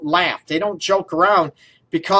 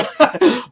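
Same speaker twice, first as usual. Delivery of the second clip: short high laugh burst; high giggle; 7 semitones higher than usual. Real, not acted. Host cracking up at her own slogan idea, giggling. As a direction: giggle at your own joke.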